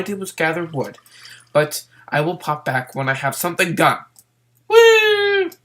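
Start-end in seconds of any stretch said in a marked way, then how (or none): 4.7-5.5 much higher, loud excited whoop; real hyper yell of joy. Real, not acted. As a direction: let out a wild cheer. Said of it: "calmed down from my hyperness and craziness".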